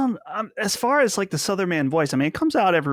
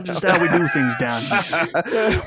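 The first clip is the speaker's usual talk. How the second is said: Southern Man voice. Acted. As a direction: play the good-ol'-boy, grinning.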